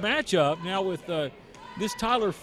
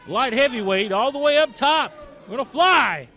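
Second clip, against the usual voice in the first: louder and higher as he climbs; building excitement; 6 semitones higher, 6 dB louder than usual. Real, not acted. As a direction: ramp up the hype to a peak. High-flyer climbing the ropes.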